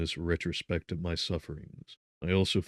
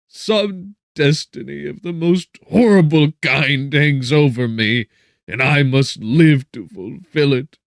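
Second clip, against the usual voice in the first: loud, high and slow despairing cry; tearful lament; 8 semitones higher, 14 dB louder, slower than usual. A narrator's reading. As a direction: lament your fate through tears. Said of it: "I shed tears".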